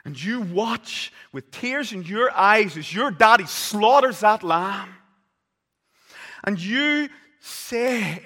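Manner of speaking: loud, high, driving sermon voice; thundering pulpit preaching; preaching at full force. Acted. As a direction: preach it out to the congregation. Preaches the Passover scene.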